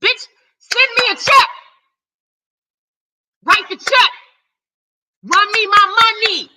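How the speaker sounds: high, loud furious demands; angry demand. Real, not acted. Snapping at a channel hater to pay his bills, high and loud. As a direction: yell it at the hater like they owe you.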